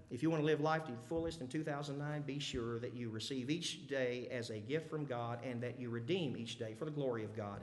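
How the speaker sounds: usual speaking voice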